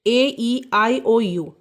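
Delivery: slow spelled vowels. Shown as a demonstration. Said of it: "जैसे ए लगेगा".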